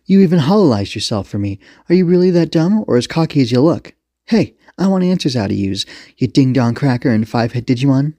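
mock the suspects and demand answers; taunting police commander.

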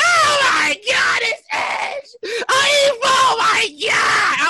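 high, long-drawn-out squeal; hammed-up tearful fan wail; fanboy meltdown. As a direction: stretch every word like an overwhelmed fan. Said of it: "like I was a fanboy".

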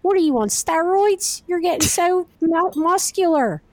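put-on high, drawn-out Delco accent; Delco aunt impression. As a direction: mimic a friend's aunt in shrill, slow Delco. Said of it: "he does impressions of her".

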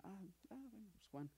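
mock indifferent reaction; dismissive shrug. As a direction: toss it off lightly. Imagined casual reaction of Jesus.

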